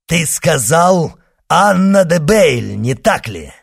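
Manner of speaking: loud, high, urgent questioning; fierce exclamation. Acted. Monk makes sure of the name, crying out. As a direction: demand confirmation at full voice.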